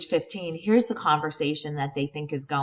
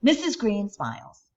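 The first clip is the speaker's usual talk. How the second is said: ordinary delivery, nothing marked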